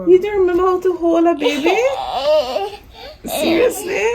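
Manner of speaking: fast, laughing and teary; tearful laughing disbelief; laughing tease. Real, not acted. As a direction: laugh through happy tears. Laughing teasing daughter holding baby.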